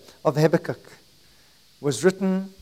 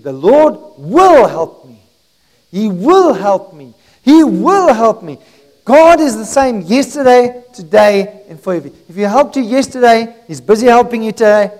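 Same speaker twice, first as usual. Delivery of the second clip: full-force preaching; 5 semitones higher, 11 dB louder than usual. Acted. Preacher declares God's help to the church, full-force preaching. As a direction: preach it loud, stretching the key words.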